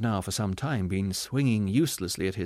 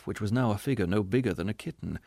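ordinary delivery, nothing marked

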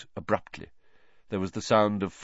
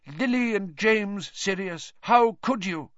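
mock sobbing; 11 semitones higher than usual. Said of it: "he was sobbing".

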